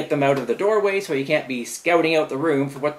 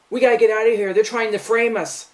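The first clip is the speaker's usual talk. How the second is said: mild mock yell, pitched up; retold yell; 6 semitones higher than usual. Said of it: "he's yelling at his".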